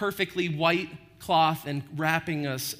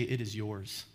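softer, lower, giving it away gently; tender reassurance; 7 semitones lower, 8 dB quieter than usual. Retold or imitated. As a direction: hand it over like a gift, hushed. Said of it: "and I will say".

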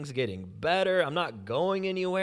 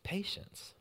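whispered word; 5 semitones higher, 9 dB quieter than usual. Real, not acted. Preacher hushing the congregation on "patience", whispered.

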